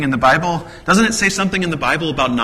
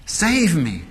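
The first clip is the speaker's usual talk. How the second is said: a touch of panic, higher pitched; crying out for help; 5 semitones higher, slower than usual. Acted. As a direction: call out like a sinking man, lightly voiced. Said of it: "he cries out".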